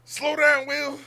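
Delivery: high, loud fleeing cry; whining to Will while running; mock panicked plea. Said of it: "It's like".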